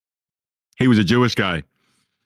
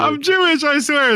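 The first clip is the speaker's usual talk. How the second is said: much higher, louder protest; pleading voice; 3 dB louder, slower than usual. Retold or imitated. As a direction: squeak out the denial. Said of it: "He'd be like".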